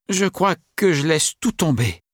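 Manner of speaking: the tired writer gives up; weary major. A narrator's reading. Major's voice intrudes.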